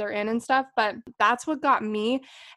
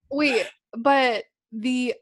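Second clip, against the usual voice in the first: normal talking voice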